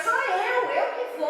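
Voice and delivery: high, loud "it's me" cry; eager insistence. Retold or imitated. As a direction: push yourself forward, eager to go. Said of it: "Aí ela". (voz alta e aguda, ansiosa para ir)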